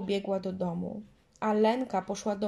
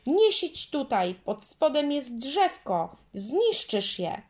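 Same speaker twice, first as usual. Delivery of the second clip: higher, louder, slow yell; louder slow yell at the little girl; 6 semitones higher, 5 dB louder, slower than usual. A narrator's reading. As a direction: shout to stop her. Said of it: "wrzasnęła Marysia".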